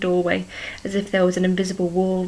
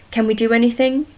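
louder and higher urgent question; desperate plea; 4 semitones higher, 6 dB louder than usual. A narrator's reading. Henrietta begging Agnes for a way to save her, desperate. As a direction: beg for any way out.

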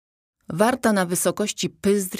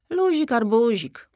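higher-pitched casual call back; 7 semitones higher, 3 dB louder than usual. A narrator's reading. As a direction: toss it back as a breezy farewell. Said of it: "odkrzyknął Ropuch".

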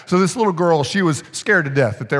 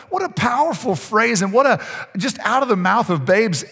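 usual speaking voice

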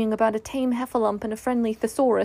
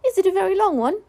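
high, louder little creature voice; little animal asking keenly; Piglet; 9 semitones higher, 5 dB louder, faster than usual. Acted. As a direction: ask in an eager, high-pitched small voice.

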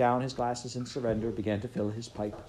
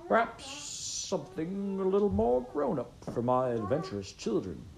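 higher, arch fatherly suggestion; a raised-eyebrow hint; prim father; 9 semitones higher than usual. A narrator's reading. Father nudging Heather toward a grown-up tale, arch. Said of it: "Father said".

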